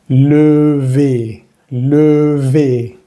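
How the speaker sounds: levé as "leu-vé", rounded then spread; slow syllable-by-syllable levé; French levé demo. Shown as a demonstration. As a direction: draw out levé slowly, first vowel rounded. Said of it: "Esta palabra nos queda".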